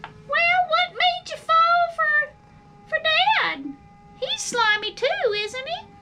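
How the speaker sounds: put-on little worm child teasing mom about dad; young child worm. A narrator's reading. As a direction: ask mom like a curious kid. Child worm asks mother.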